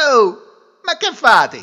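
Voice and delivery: loud, high startled hail; calling out. Acted. Groom calling to the farmer, loud hail. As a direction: shout a puzzled question at a stranger.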